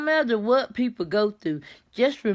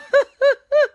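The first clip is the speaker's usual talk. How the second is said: high, loud cackle of real amusement; real high giggling burst; tickled laugh; 8 dB louder than usual. Real, not acted. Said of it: "I get so tickled sometimes".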